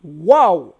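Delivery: amazed wow. Real, not acted. Marvels at the hieroglyph link.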